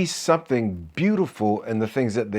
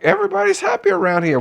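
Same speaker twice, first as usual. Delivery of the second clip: excited burst; 5 dB louder, faster than usual. Real, not acted.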